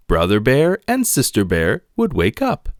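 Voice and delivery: ordinary delivery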